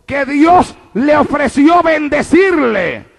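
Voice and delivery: rising, emphatic pulpit delivery; preaching at full force. Real, not acted. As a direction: proclaim it to the whole church. Predica a plena fuerza. (predicando con fervor a plena voz)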